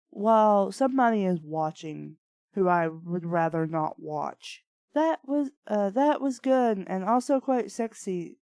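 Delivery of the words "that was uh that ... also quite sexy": ordinary talk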